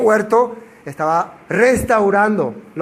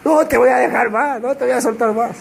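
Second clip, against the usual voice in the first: clinging plea; 5 semitones higher than usual. Acted. Mary clinging to Jesus.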